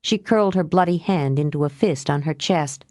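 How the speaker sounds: plain reading voice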